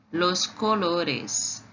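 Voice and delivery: "los colores" said slowly; Spanish pronunciation. Shown as a demonstration. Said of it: "cores em espanhol se diz assim, ó".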